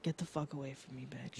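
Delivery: fast hushed curse muttered to herself; muttered insult. Retold or imitated. Retelling a flight rant to a friend, muttered low and fast. Hostile quote muttered at seatmate.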